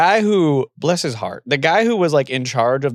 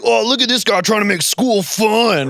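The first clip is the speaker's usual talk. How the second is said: mocking; 8 semitones higher than usual. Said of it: "People was like".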